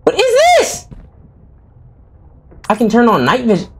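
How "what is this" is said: startled squeak. Real, not acted. Sudden scare in horror game.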